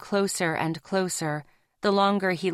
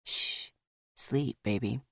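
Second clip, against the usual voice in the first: low, much softer lover's hush; soothing hush; 7 semitones lower, 7 dB quieter than usual. Acted. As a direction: lull her back to sleep. Rafe soothing Zoe in bed.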